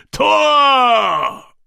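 long roaring battle cry; shout. A narrator's reading. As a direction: hold the bellow out to the sky. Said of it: "rief er und hob das Gesicht dem Himmel entgegen".

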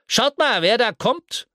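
high, loud, gleeful call; eager creature voice. Acted. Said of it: "hörte er eine Stimme".

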